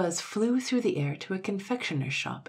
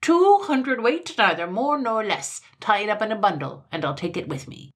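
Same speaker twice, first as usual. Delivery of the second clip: bossy, emphatic command; imperious insistence; 6 semitones higher, 8 dB louder than usual. A narrator's reading. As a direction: lay down the order with no argument allowed. Imperious mannikin repeats his order.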